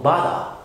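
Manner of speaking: BOTHER ending in "-thuh"; British bother, no R; British silent R. Shown as a demonstration. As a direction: drop the final R of bother. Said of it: "لكن في البريتش إنجلش بنقول".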